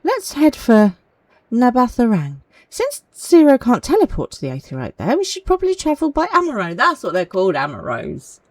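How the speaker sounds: bright eager travel call; eager read. Acted. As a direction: push it forward with excitement. Reading dialogue with energy.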